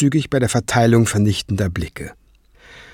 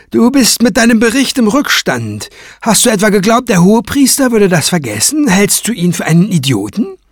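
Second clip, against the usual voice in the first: high, louder taunting scold; sneering reproach; 10 semitones higher, 3 dB louder than usual. Acted. Craven scolding Kranz for the late report, high and taunting. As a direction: needle him with every question.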